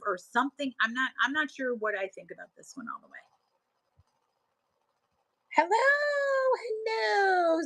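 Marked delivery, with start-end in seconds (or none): 5.5-7.6 high, drawn-out hello, sung out; real delighted high-pitched hello; excited greeting. Real, not acted. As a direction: call out a welcome way up high. Greets a viewer arriving on stream.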